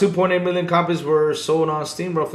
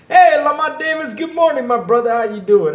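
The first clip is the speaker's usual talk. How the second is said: much higher, louder, cheerful hello to a viewer; excited greeting; 11 semitones higher, 8 dB louder than usual. Real, not acted. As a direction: call out a big welcome.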